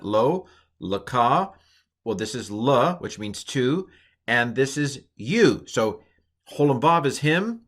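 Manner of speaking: ordinary talk, nothing marked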